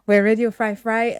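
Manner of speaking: ordinary talk, nothing marked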